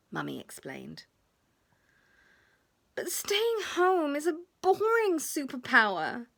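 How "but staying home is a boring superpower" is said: put-on high child voice, sulky and grumbling; grumpy. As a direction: whine that it's boring like a grumpy kid. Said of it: "said William in a grump".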